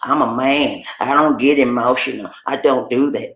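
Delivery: macho man in denial. Retold or imitated. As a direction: put on a low, manly voice of denial. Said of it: "You, you say".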